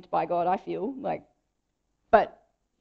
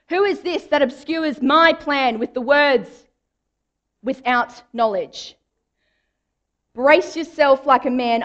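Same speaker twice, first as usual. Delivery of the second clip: booming God, higher and much louder; God from the whirlwind; 3 semitones higher, 7 dB louder than usual. Retold or imitated. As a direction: thunder down at Job as God. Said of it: "God ... comes out of a whirlwind".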